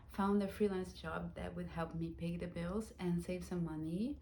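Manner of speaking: normal talking voice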